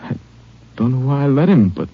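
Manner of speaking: broken account with long pauses between phrases; wounded, halting. Acted. Wounded deputy explaining about his kid to the Ranger, halting. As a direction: struggle through it, stopping often.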